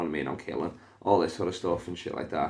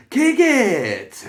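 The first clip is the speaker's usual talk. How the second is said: high, drawn-out shout; Bernie Mac line; 12 dB louder than usual. Retold or imitated. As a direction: holler it like a stand-up catchphrase. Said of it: "slipped in a Bernie Mac line".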